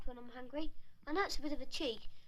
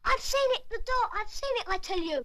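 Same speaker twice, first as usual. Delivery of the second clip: high and loud, bursting with the news; excited; 6 semitones higher, 3 dB louder than usual. Real, not acted. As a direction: blurt it out, thrilled to know. Boy suddenly recognises the door.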